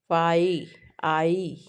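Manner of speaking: फाई then आई, the vowel isolated and held; vowel demonstration. Shown as a demonstration. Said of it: "ऐसे count कर सकते हो". (फाई, आई के स्वर को अलग खींचकर)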